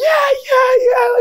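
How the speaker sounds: high, shrieking cheer; a squealing replay of celebrating; excited cheering. Retold or imitated. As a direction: shriek it like your team just scored. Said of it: "cheering for my team".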